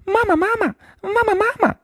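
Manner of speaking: very high little duckling cries; 小鸭子高声叫妈妈; duckling calling mama. A narrator's reading. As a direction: call out like a newborn duckling. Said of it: "叫着".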